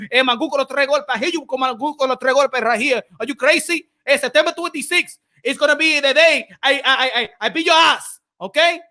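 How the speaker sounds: put-on brash Dominican accent, voice up high; Jason Rosario impression. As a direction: do a hyped Dominican boxer calling out the Charlos, Spanglish accent. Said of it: "If he brings that out, that machismo".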